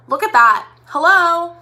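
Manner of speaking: loud, high delighted hello; excited. Real, not acted. Makeup vlogger to viewers, loud thrilled admiration. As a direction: show off the pigment, thrilled.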